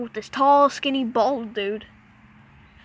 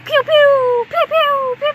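high, loud laser pew noises; mouth gun sound effects; 14 semitones higher, 6 dB louder, slower than usual. Pretend. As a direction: fire off pretend blaster shots. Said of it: "Like my nice sound effects?".

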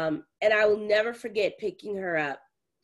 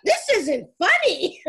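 high, loud protest through amusement; mock indignant; 11 semitones higher, 6 dB louder than usual. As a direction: imitate an angry daughter, close to laughing. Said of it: "she's over there mad as hell".

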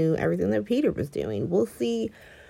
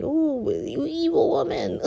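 slow, higher mock gasp at an evil woman; mock scandalized; 9 semitones higher than usual. Mocking the Cassadines calling Ava evil, slow hammed-up. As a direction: ham up a scandalized accuser.

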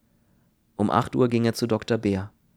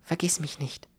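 soft whispered plea, pitched up; smiling through tears; 6 semitones higher, 5 dB quieter than usual. A narrator's reading. Ruth parting from Kern, smiling through tears. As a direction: whisper it tenderly through held-back tears. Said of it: "Sie lächelte unter Tränen".

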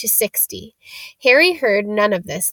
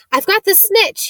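high, louder triumphant shout; 10 semitones higher, 4 dB louder than usual. A narrator's reading. Harry shouting to the stands, triumphant shout. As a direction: yell it out in triumph. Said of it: "he shouted".

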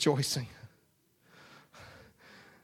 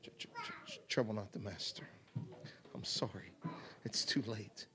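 low, much softer, stammered hush; said quiet; 6 semitones lower, 16 dB quieter, faster than usual. Acted. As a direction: barely voice the bad news, almost a whisper. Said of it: "he just said it quiet".

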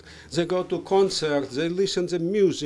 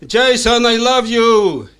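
loud declaration; 8 semitones higher, 11 dB louder than usual. Shown as a demonstration. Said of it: "Everybody say".

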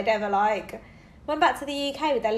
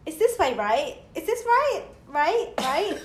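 lightly higher, pleading "right?" over and over; needy kid; 4 semitones higher, slower than usual. Retold or imitated. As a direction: nag like a four-year-old diva. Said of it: "Mason's like".

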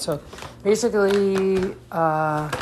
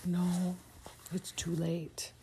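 whispering; 2 semitones higher, 12 dB quieter, slower than usual. Real, not acted. Parent hushing child at bedtime.

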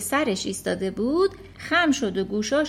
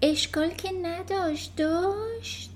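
worried and hesitant; 7 semitones higher, slower than usual. Acted. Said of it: "آهسته با نگرانی پرسید".

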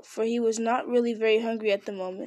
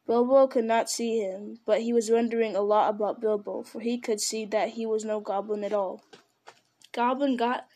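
ordinary delivery

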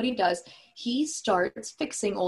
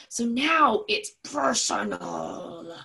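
slow, drawn-out dramatic declaration; hammed-up dramatic growing slowness; mock dramatic; much slower than usual. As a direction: draw it out like a movie trailer. Mock-dramatic movie line.

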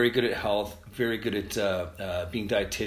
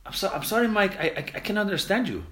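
higher, light apologetic voice; bandmate apologizing; 10 semitones higher, 3 dB louder than usual. Retold or imitated. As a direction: gently say you can't follow him. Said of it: "he's like".

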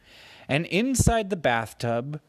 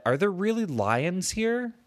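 put-on curious child; 7 semitones higher than usual. Boy asks about lions.

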